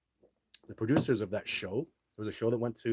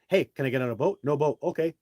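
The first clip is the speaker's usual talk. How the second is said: loud, higher acted dialogue; acted back-and-forth; 5 semitones higher, 10 dB louder than usual. Host acting out asking the crew for a boat, loud. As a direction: play both sides at full volume.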